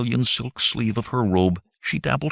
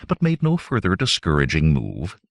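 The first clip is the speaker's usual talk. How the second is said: ordinary delivery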